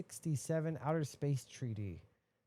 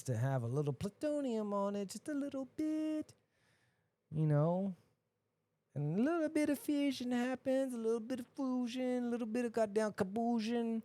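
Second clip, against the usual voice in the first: mock innocent; 7 semitones higher than usual. Mock-innocent understatement of nuking.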